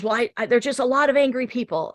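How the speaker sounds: mildly flustered, rushing to explain yourself; kind of flustered. Retold or imitated. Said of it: "she was kind of flustered".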